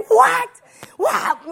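high, loud outraged yelp; going crazy. Retold or imitated. Wife hearing the plumbing is broken, going crazy. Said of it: "I was gonna go crazy".

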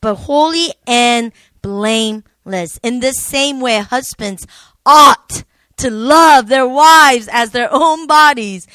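loud, impassioned scripture reading, hammering key words; emphatic, passionate reading. Real, not acted. Wife reads on after being teased about her passion, loud and emphatic. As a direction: belt out the verse with conviction, stretching the big words.

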